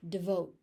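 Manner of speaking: "Devote" as de-VOTE; short "de" in "Devote", weight on VOTE; second-syllable stress. Shown as a demonstration. Teacher showing the stressed syllable, second-syllable stress. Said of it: "stress goes into the second syllable".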